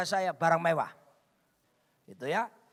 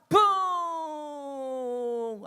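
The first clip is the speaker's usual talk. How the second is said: high "buuu" gliding slowly down; long plane whoosh; 6 semitones higher, 4 dB louder than usual. Retold or imitated. Preacher imitates long flight to Taipei, drawn-out plane noise.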